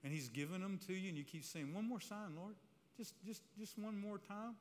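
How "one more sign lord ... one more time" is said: high, soft mock plea; pleading, pitched well up. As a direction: voice a believer begging for one more chance. Voicing listeners begging God.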